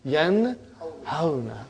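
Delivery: Yanhauna stretched very slowly, a small laugh in it; pleased, model Yanhauna slowly; slow demonstration with a laugh. Shown as a demonstration. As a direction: Yanhauna drawn out, amused. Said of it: "See, it changed".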